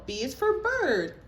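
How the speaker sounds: slow, loud sounding out. Real, not acted. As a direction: correct gently, stretch each sound. Teacher correcting child.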